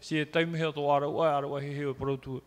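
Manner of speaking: ordinary delivery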